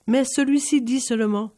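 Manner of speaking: plain reading voice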